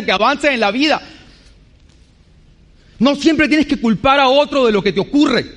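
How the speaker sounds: ordinary talk, nothing marked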